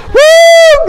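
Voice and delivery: high ecstatic woo shouted loud; full-voiced shout of praise; joyful shout. Real, not acted. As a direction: whoop it out to the rafters.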